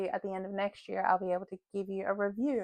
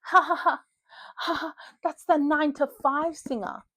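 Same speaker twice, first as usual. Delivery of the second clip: higher, louder mock-gleeful exclamation; amused texting voice; 5 semitones higher, 4 dB louder than usual. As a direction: exclaim it like a giddy text to friends. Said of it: "typing out a message being like".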